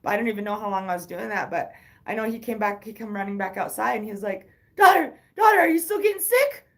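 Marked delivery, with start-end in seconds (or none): 4.8-6.7 high, loud worried call; frantic dad calling across the yard; dad shouting. Retold or imitated. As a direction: yell out for your daughter.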